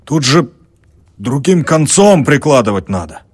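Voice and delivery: high, loud know-it-all proclamation; loud announcement. A narrator's reading. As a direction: announce it triumphantly. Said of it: "ямщик объявил".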